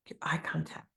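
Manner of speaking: unvoiced whisper mid-list; whispered aside. Real, not acted. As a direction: tuck the phrase into a whisper. Coach drops into aside.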